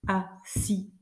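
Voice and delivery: very slow scansion with gaps between syllables. Shown as a demonstration. Teacher checking the alexandrine for pupils, slowly syllable by syllable. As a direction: break the verse into separate, evenly spaced syllables. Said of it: "Donc, on va compter".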